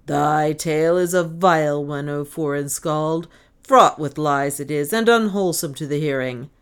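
loud, angry denunciation; angry calling out. A narrator's reading. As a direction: hurl an accusation at the stranger. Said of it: "listened to it with growing anger".

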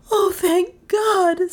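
high, drawn-out sobbed cry; tearful, high-pitched wail of thanks; sobbing relief. A narrator's reading. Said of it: "sobbed Dale".

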